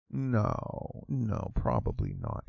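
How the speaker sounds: unvoiced, dry whispered no; whispered aside. Real, not acted. Vlogger answering his own question under his breath, whispered. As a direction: mutter the answer to yourself.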